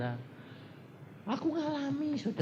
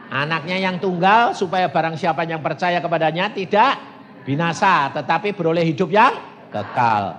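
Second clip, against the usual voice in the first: raised voice, forceful preaching; loud verse recitation; 6 semitones higher, 10 dB louder than usual. Acted. Preacher recites John's verse for the congregation, loud declaiming. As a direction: declaim the verse at full volume.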